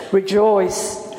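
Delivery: low, resounding voice of God; booming God voice. Shown as a demonstration. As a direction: boom it out deep like God. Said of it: "your booming God voice".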